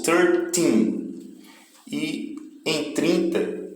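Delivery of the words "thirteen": put-on thirteen with the weight on teen; stress on the end. Shown as a demonstration. Teacher repeating thirteen for students, stress on teen. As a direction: lean on the last syllable of thirteen. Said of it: "O final é que tem mais força".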